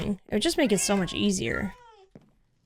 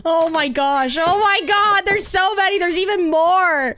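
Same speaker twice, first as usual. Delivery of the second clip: excited; 6 semitones higher, 5 dB louder than usual. Real, not acted. Sees a crowd of cats.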